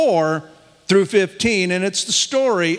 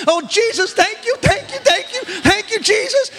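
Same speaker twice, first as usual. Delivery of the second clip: very high, rapturous mock outburst; imitated ecstatic praise. Imitating a woman thanking Jesus on the phone, ecstatic. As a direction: mimic an overjoyed caller praising at high pitch. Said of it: "and then she breaks".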